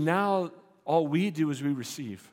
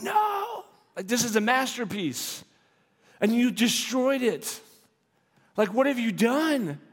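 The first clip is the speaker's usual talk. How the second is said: mock horrified outcry; 6 semitones higher than usual. Viewer horrified that the masterpiece was ruined, outcry. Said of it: "You're like".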